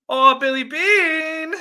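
high, loud, drawn-out greeting; calling out. Real, not acted. Host greeting a viewer in chat, drawn-out shout. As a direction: holler the name slowly and high.

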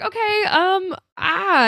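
high-pitched awkward okay; a light high mock of dismay. Wendy voiced, dismayed.

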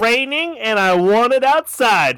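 high, loud mock demand; hammed-up loud insistence; insistent shouting. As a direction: shout down the objection. Overriding objection in acted bit.

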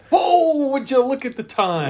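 high, loud fake surprise; a hammed-up whoa at the clock; mock surprise. Acting Russ's excuse.

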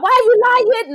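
high, loud retold challenge; indignant accusation. Child confronting brother over the missing bike, loudly indignant.

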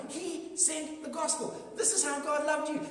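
ordinary delivery

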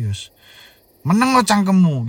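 mother's sharp hushed rebuke rising to a shout; mother snapping. Retold or imitated. Mother scolds crying son in the dark, sharp shout. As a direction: scold the child to shut up.

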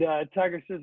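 usual speaking voice

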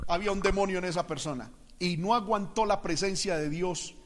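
ordinary talk

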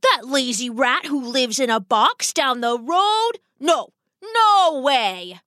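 outraged rat papa, drawn-out refusal; spluttering indignation. Acted. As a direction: reject the suitor with theatrical scorn. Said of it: "Mister Rat spluttered".

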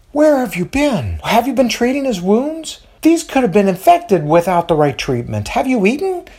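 high, worried motherly questions; fussing mother voice. A narrator's reading. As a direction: fuss over him like a mother.

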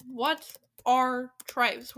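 loud, very slow, word by word; heavily drawn-out, emphatic question; slow bafflement. Real, not acted. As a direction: spell out the question in disbelief. Repeats the baffling question slowly.

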